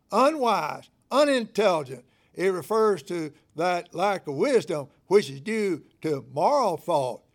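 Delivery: loud, high, ringing pulpit preaching; preaching at full force. Acted. Pastor preaching obligation to his church, full-force loud delivery. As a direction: preach it out over the congregation.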